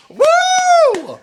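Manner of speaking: high, loud whoop; cheer. Pretend. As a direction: whoop like a fan in the crowd. Cheering the performer.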